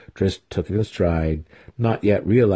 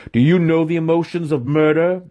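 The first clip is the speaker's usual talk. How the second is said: high, forceful, accusing question; spat out; 9 semitones higher, 4 dB louder than usual. A narrator's reading. Mentor goading his student mid-fight, spitting it out. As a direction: spit the question at him. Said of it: "he spat".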